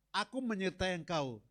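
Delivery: loud, rising proclamation of God's promise; preaching God's words. Acted. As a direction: build it up and proclaim it. Preaching God's words at full force. (seruan khotbah yang lantang dan berapi-api)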